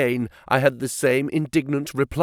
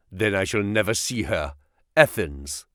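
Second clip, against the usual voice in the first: low, guarded prisoner voice; defiant captive; 6 semitones lower than usual. A narrator's reading. Captive answering his interrogator, low and defiant. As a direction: answer in a low, deliberate register.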